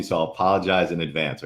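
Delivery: ordinary delivery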